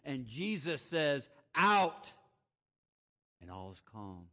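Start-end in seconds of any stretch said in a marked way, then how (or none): 1.5-2.1 much louder, higher shout; forceful shouted command. Acted. Jesus casting out demons. 3.4-4.3 much softer, lower; quiet, lowered voice; sudden hush. Acted. Sudden hush after shout.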